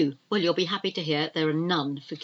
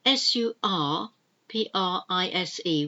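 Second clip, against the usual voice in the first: ordinary talk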